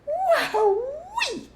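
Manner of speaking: very high, loud delighted whoop; excited whoop. A narrator's reading. Cub after fiery gulp.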